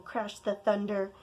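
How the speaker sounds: plain reading voice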